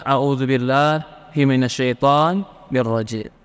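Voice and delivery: slow, measured Arabic recitation. Retold or imitated. The Prophet teaching the angry man, slow recitation. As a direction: recite it slowly and reverently.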